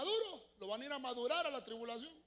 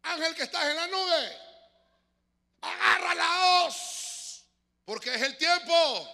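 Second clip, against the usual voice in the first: llamado agudo y muy fuerte, lento; grito del ángel; 7 semitones higher, 12 dB louder, slower than usual. Acted. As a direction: grita al ángel en la nube. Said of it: "Entonces dice". (grito potente y solemne)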